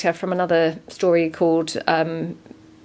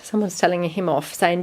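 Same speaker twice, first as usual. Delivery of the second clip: ordinary talk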